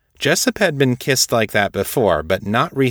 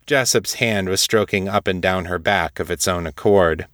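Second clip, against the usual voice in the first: usual speaking voice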